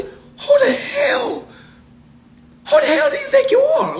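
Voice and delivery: cracking high-pitched yell of disbelief; outraged shout. Real, not acted. Scolding viewers who expect remedy in weeks, cracking shout.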